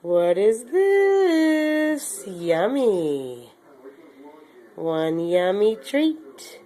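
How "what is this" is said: talking to a dog. Real, not acted. Teasing dog with treat.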